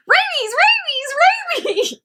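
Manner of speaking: high, squealing mock-rabid cry; squeaky rabid act. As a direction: yelp it like a dog faking rabies. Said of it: "And she's like".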